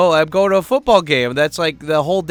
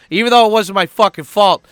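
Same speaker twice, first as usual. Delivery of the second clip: louder, higher irritated aside; indignant; 6 semitones higher, 6 dB louder than usual. Real, not acted. Venting about the buddy he apologized to, indignant. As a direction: burst out in exasperated self-defense. Grumbles after retelling his apology.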